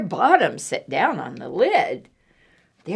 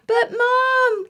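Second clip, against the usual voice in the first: put-on high, urgent child's plea; gasping boy. Eddie gasping as Mom leaves, high and urgent. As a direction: call after her in a panic. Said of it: "gasped Eddie".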